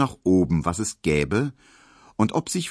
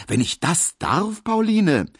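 eager Emil; 12 semitones higher, 4 dB louder than usual. A narrator's reading. Emil voiced by narrator.

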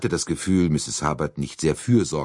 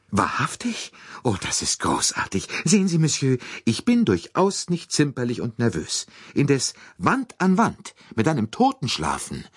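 put-on higher, relieved then fretful old lady; high, chattering lady voice; fussy American lady; 10 semitones higher than usual. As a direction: gush and fuss like a nervous matron. Mrs Hubbard voiced.

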